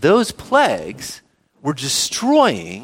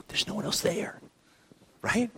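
whispered aside; 3 semitones higher, 15 dB quieter than usual. Acted. Whispered aside in island illustration.

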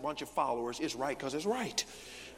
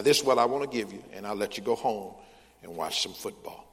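ordinary delivery

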